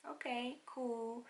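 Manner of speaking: slow, drawn-out mock calm; sarcastic okay cool. Mother hearing about the bleed, slow mock calm. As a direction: play it dry and deadpan.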